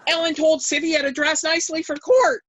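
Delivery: high, loud, excited announcement; retold screech. Sister announcing the news to Svaton, excited screech. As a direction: squeal the news out to everyone. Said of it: "now I'm just screeching".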